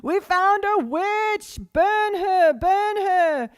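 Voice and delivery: shrieked high and loud, slow on the first line; mob shout. Retold or imitated. Villagers demanding the magistrate burn her, shrill mock yell. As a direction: whip the crowd up to burn her. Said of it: "and they say".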